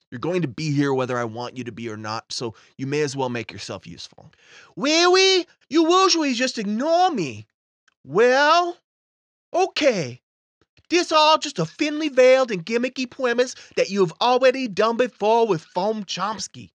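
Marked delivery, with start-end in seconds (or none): 4.8-16.7 put-on high, loud excited kid; a needy child finally noticed; little boy voice. Timmy voice answers host.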